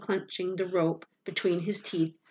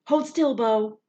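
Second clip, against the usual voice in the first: higher, louder call down; hollered; 5 semitones higher, 7 dB louder, slower than usual. A narrator's reading. Boy calling down a crevasse to his dog, hollering. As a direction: holler so the dog holds still. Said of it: "I hollered".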